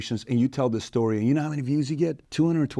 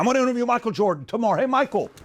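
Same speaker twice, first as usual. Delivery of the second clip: high-pitched, hammed-up excitement; overeager novice host; 8 semitones higher than usual. New podcaster begging a star for an interview, mock-eager. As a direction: gush as if hailing a superstar. Mimics novice chasing famous guests.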